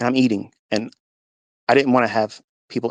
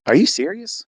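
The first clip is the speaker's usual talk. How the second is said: high-pitched disbelieving question; retold astonishment; incredulous; 10 semitones higher, 3 dB louder than usual. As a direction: ask it in stunned disbelief, pitch shooting up. Husband reacting to pregnancy test.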